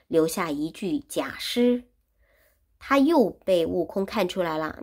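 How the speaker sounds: usual speaking voice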